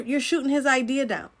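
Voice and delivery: usual speaking voice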